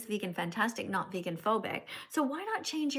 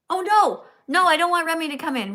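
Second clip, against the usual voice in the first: alarmed outburst; 6 semitones higher, 10 dB louder than usual. Real, not acted. Unwanted guest trying to join.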